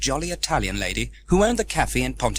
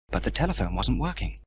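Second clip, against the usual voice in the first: ordinary delivery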